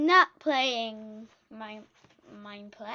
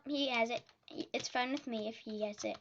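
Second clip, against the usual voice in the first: ordinary talk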